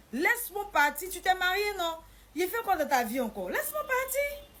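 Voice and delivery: high, loud outburst; heated demand. Retold or imitated. Wife demanding to be let go, heated. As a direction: shoot up high and loud in protest.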